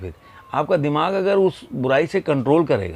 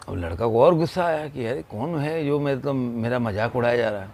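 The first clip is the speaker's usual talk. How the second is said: ordinary delivery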